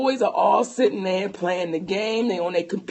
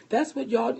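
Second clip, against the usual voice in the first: soft, even reassurance; calm; 7 dB quieter than usual. Retold or imitated. Husband reasoning with his upset wife, calm and soft. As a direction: reason gently in a hushed imitated voice. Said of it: "this is just how calm he's talking to me".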